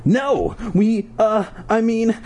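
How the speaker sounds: high, flustered stammer. A narrator's reading. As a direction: stumble over the words nervously. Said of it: "I stammered".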